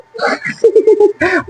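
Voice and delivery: high-pitched cackle; mocking laugh. Real, not acted. Preacher after telling rival pastor he is not born again, mocking cackle. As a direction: break into a high, derisive laugh.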